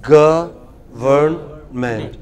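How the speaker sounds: "Government" very slow, stress on the first syllable; teacher drills stress on "Government". Shown as a demonstration. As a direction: hit "Gov" and stretch out the rest. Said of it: "put the stress on the first".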